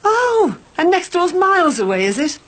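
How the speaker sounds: high scornful sarcasm; mock him with a pointed question; sarcastic. Mother's sarcastic retort.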